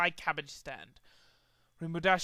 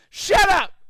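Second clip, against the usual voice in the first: very loud, high yell; heckler's shout; 13 semitones higher, 13 dB louder than usual. Acted. Pony in the audience heckling Trixie, very loud high yell. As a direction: shout down the performer.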